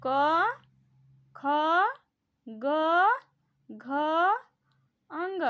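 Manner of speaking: loud, clear demonstration of each consonant; sounding out the letters. Shown as a demonstration. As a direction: say each letter slowly and distinctly from the throat. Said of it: "खुद उच्चारित करके देखो, बोलो".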